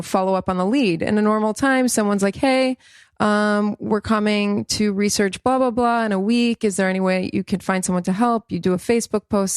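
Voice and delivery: ordinary talk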